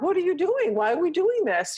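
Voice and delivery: dog in pain questioning the owner; voicing the confused dog. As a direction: play the dog asking what's happening. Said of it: "She was like".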